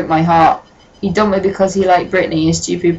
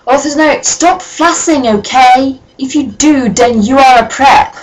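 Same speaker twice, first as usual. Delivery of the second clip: mocking whiny voice; 6 semitones higher, 3 dB louder than usual. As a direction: sneer it like a petty scold. Mocking the author's note.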